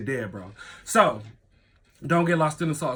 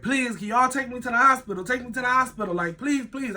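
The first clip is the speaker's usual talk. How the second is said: high, urgent acted pleading; desperate begging; 7 semitones higher than usual. Speaker bangs on neighbours' doors for hospital ride, desperate high pleading. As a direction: plead repeatedly with the voice raised.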